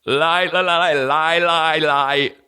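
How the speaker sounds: high strained stammer on lie; stuttering king. Retold or imitated. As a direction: fight to get the word out. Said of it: "trying to fucking speak".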